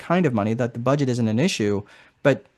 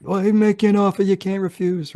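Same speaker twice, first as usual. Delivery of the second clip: higher, gruff-leaning mob boss impression; Godfather voice; 6 semitones higher than usual. Coach hams up the Godfather for the class. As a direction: play the Godfather making his offer. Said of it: "You can even say it in the voice if you like".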